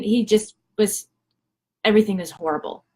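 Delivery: usual speaking voice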